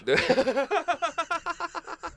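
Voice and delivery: high, long burst of laughter. Real, not acted. Laughing hard at his mate's joke, high and long. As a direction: crack up at the joke.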